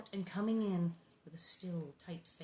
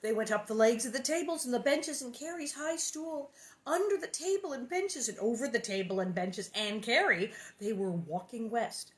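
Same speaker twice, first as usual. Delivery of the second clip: plain reading voice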